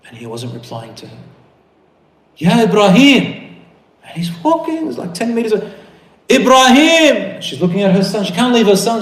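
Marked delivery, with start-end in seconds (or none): 2.4-3.6 high, loud, drawn-out call of his name; calling after him. Acted. Wife calling after departing husband. 6.3-7.4 high, loud, stretched-out cry; desperate shout. Acted. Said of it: "so she starts shouting".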